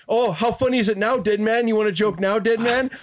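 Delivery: high-pitched mock taunting voice; Yoko's taunt. Yoko sitting on Undertaker's chest, mock gloating taunt. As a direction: imitate a gloating wrestler, pitched well up. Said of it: "And he's like".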